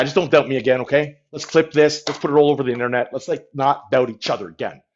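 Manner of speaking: ordinary talk, nothing marked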